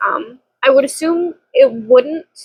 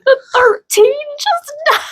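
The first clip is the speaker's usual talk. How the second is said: very high wailing; imitating crying. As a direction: replay the sob of the moment. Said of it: "and I was like".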